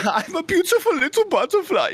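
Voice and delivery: high put-on dreamy voice; high cartoon caterpillar voice; caterpillar from Bug's Life. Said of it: "the caterpillar from Bug's Life".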